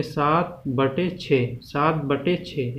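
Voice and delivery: slow, deliberate "सात बटे छः" with बटे stressed; slow reading aloud. Shown as a demonstration. Teacher reading a fraction slowly for students. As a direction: spell it out slowly for the class. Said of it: "इसको क्या बोलेंगे".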